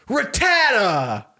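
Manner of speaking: high, drawn-out battle shout; angry summons. Retold or imitated. Embarrassed Ben sending out his tiny Pokemon, angry shout. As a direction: shout the name as a defiant battle cry. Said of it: "he still has that anger".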